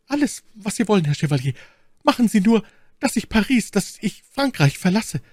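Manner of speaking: high, pleading maid's voice; flehende Ketty. Acted. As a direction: beg him in a high, urgent voice. (flehende, hohe Mädchenstimme)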